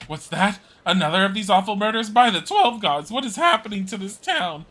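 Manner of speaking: high, loud, aghast exclamation; shocked outcry. Acted. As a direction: gasp out in horror at the news. Old woman shocked by third murder.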